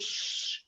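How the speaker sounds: soft unvoiced shush; whispered shush. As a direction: hush the other woman gently. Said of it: "He said".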